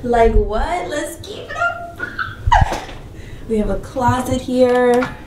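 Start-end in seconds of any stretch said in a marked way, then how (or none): 0.0-0.9 louder, rising "Like, what?"; loud disbelief. Real, not acted. Reacting to the toilet, loud incredulous. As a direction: blurt it out in disbelief. Whisper then loud repeat.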